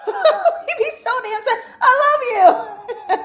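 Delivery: high, slow, giggling I love you; laughing mock confession. Host mocking how earth signs blurt it, laughing. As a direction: squeak it out mid-laugh.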